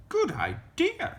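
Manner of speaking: put-on high little-girl reply; Little Red character. A narrator's reading. Little Red agreeing to the wolf, high girlish voice.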